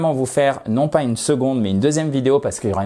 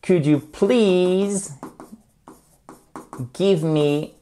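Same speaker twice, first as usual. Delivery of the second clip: "Could you please" stretched out, higher; slow drill; 6 semitones higher, much slower than usual. Shown as a demonstration. Teacher repeats the request slowly for learners. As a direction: draw each word out for the class. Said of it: "slow drill".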